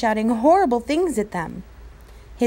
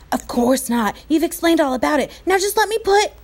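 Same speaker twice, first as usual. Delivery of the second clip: put-on eager boy voice; 7 semitones higher, 3 dB louder than usual. A narrator's reading. Patrick arguing, eager.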